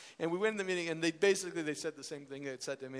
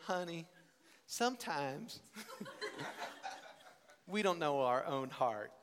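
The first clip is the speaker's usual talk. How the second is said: put-on mother's Southern accent; 3 dB quieter than usual. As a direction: imitate mom's sage Southern accent. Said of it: "she goes into a stronger Southern accent".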